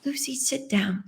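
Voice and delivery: breathy whispered instruction; retold whisper; mock whisper. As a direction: whisper it like a hushed director.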